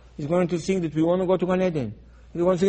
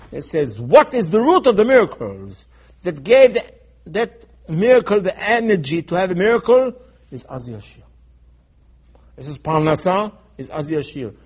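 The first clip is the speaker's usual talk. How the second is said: normal talking voice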